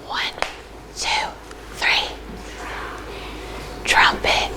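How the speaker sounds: soft unvoiced whisper for the class; hushed classroom whisper. Shown as a demonstration. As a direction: whisper the count, then the word. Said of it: "in a whisper voice".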